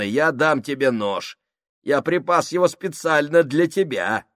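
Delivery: сильно выше и громче, глумливо; издевайся над ним в полный голос; громкая травля. Acted. Сакс травит Крайчика. (Сакс травит Крайчика, громко и глумливо)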